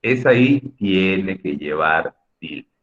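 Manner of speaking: slow, weighty "Esa I tiene que llevar tilde" leaning on I; stress on the I. Shown as a demonstration. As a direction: slowly drive home the I. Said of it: "teacher emphasis". ("Esa I tiene que llevar tilde" lento, recalcando la I)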